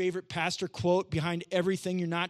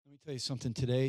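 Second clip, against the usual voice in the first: normal talking voice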